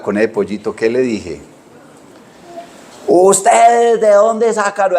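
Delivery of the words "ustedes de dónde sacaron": high, slow scolding grandma voice; scolding grandmother. Retold or imitated. Grandmother scolding the boys for taking the chick, high and slow. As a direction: play the angry grandmother, pitched up and slow.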